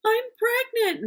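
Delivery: high, loud imitated sobbing plea; retold crying. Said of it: "starts crying".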